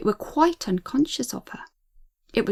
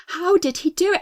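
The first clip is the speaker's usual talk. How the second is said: higher, startled question; alarmed disbelief; 6 semitones higher, 4 dB louder than usual. A narrator's reading. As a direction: ask it in rising alarm. Frightened girl's thought, repeated.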